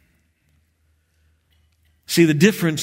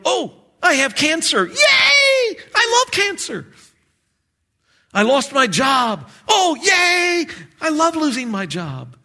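high, loud, hammed-up fake cheer; mock joy; 13 semitones higher, 4 dB louder than usual. Preacher mocks forced joy in trials, squealing high. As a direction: squeal fake delight at bad news. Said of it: "doesn't tell us to just be joyful".

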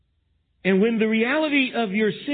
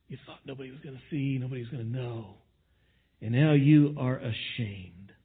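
grave quiet warning; 8 semitones lower, 5 dB quieter than usual. Acted. God's words, voiced low.

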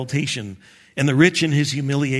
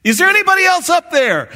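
panicked plea; 12 semitones higher, 6 dB louder, faster than usual. Acted. Desperate man asks for another.

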